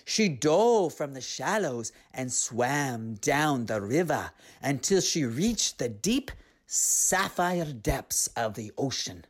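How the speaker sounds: plain reading voice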